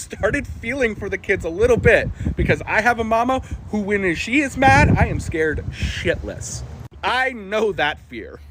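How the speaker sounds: ordinary delivery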